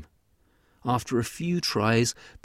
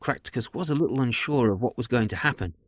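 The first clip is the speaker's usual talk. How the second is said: ordinary delivery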